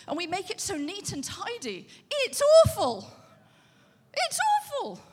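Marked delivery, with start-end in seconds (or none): 2.1-5.0 higher and louder, mock horror; a loud, high, indignant wail; exasperated outburst. Real, not acted. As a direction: cry out how awful it is. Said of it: "repeated exclamation".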